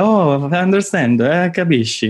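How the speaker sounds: mock Italian "got it"; understanding Italians back home. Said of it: "and then we're like".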